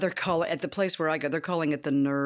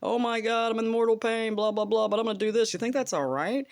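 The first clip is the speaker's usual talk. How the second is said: high, louder, hammed-up pleading text; mock dramatic plea; 7 semitones higher, 4 dB louder than usual. Drafting a text to her therapist, a hammed-up high plea. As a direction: overplay the agony to the therapist. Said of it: "And I'm like".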